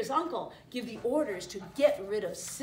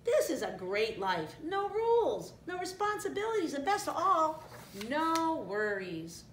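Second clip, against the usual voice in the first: meerkat; 8 semitones higher, 3 dB louder than usual. A narrator's reading. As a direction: sell the easy life as a sidekick. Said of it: "the mercat repeated".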